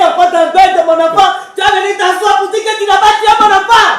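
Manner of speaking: keen the accusation at him; grieving mother wails at the accused; wailing accusation. Acted. Mother accuses the seated man.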